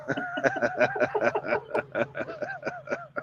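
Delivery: high-pitched burst of laughter. Real, not acted. Teller laughing at his own joke's punchline. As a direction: cackle at your own punchline.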